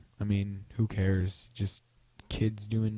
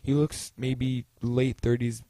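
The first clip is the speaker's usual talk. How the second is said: ordinary talk, nothing marked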